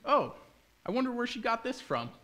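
high-pitched, idle wondering; wondering Adam. Acted. Adam puzzling over the apple he caught, high wondering voice. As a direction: muse in a put-on high voice. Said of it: "And he goes".

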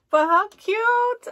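slow, high, loud gush; swooning over a cute find. Real, not acted. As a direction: stretch it out in pure delight. Gushing over gnome.

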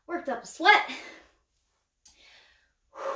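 breathless after lifting; out of breath. Real, not acted. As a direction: talk while catching your breath. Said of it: "Sorry, I'm out of breath".

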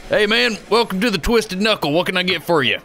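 high, loud barkeep voice; high bartender voice. Pretend. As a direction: play a chirpy high bartender.

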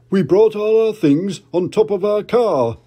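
jolly put-on dad voice; Daddy Pig. Daddy Pig remembering, hearty dad voice. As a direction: chuckle it out as a big daddy pig. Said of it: "he said".

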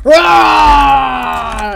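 loud high mock roar; battle roar. Police toy roaring as it attacks. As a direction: roar as you charge. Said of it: "he was just like".

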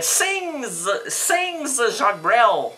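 high, very drawn-out mock British accent; put-on British accent. Redit le titre en accent annoncé. (accent britannique feint, très étiré)

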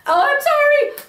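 very high squeaky apology; happy noise. Real, not acted. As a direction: squeak it out, giddy. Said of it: "That's my I'm happy noise".

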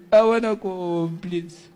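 ordinary talk

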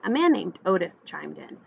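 ordinary delivery, nothing marked

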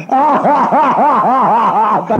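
high, loud derisive cackle in the caller's face; mocking laugh. Man at the door laughing off the soul-winner, mocking cackle. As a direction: laugh him off the doorstep. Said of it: "Just laughed".

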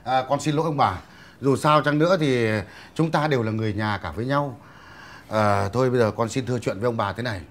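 low, soft, polite request; humble deferential plea. Acted. Young man humbly asks the elders to return the gifts, low and soft.

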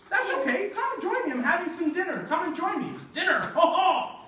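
lightly voiced high, jolly invitation; a hint of a cheery host. Herschel tricks fat goblin to dinner, lightly high and jolly. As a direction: beckon him in with a jolly lilt.

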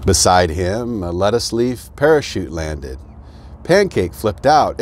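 lively rhyming storybook read; bouncy picture-book narration; reading to children. A narrator's reading. Reads picture book aloud.

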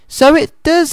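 high-pitched stepmother's concession; high, drawn-up reply; grudging agreement. A narrator's reading. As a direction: concede it in a lifted, haughty tone. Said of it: "stepmother agreed".

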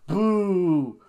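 one long, loud boo; playful crowd jeer; mock booing. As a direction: heckle with a drawn-out boo. Jeering like a crowd.

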